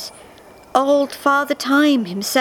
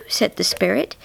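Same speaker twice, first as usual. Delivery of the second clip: usual speaking voice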